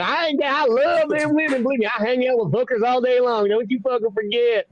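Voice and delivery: high-pitched southern braggart; exaggerated southern good-old-boy voice; southern accent. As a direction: boast in a hammed-up southern twang. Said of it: "You got this southern thing".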